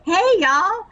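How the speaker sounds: high, hammed-up Southern drawl; bad Southern accent. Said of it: "my Southern accent was so bad".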